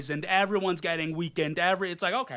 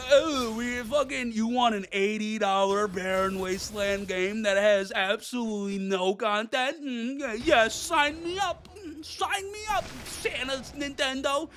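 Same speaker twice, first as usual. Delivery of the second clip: high sarcastic fanboy voice; mock Nintendo fan; 5 semitones higher than usual. Vlogger mocks Nintendo fans with a high sarcastic gush.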